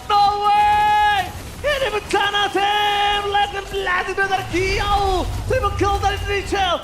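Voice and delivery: loud, high play-by-play shouting; hype caster shout. Real, not acted. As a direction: scream the big play home. Caster calls the triple kill.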